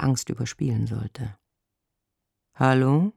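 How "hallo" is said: affectedly blasé phone greeting; a touch of put-on indifference. A narrator's reading. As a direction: answer as if bored, covering fear. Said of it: "Ich schlug einen blasierten Ton an".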